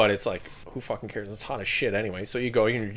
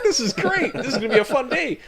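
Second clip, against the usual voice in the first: far higher, gleeful, a laugh in it; mock excited; 5 dB louder than usual. Soldiers thrilled by the Texan, high and laughing. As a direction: cheer like a kid meeting a comic-book hero.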